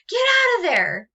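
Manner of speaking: sudden high, loud yell. Shown as a demonstration. A character yelling at someone to get out. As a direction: shout it like a character ordering someone out. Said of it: "the character might be yelling".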